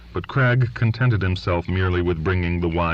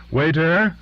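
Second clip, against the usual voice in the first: raised call across the restaurant, higher; 11 semitones higher, 4 dB louder than usual. A narrator's reading. Man calls across the room to the waiter, raised voice. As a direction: hail the waiter loudly, up high. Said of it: "he called".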